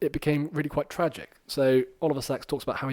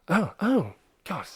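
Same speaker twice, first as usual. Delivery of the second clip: startled realisation; 7 semitones higher, 3 dB louder, slower than usual. Retold or imitated. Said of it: "And Doctor P goes".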